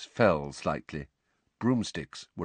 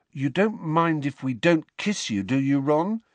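mock-worried, pitched up; falsely anxious; 7 semitones higher than usual. A narrator's reading. Brother teasing brother about not kissing him, falsely anxious. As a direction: feign concern to tease. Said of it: "in a falsely anxious voice".

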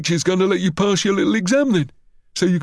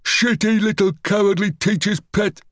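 high, gloating bully; high-pitched malicious jeer; malicious crowing; 9 semitones higher than usual. A narrator's reading. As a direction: crow spitefully over a weaker boy. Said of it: "Green crowed maliciously".

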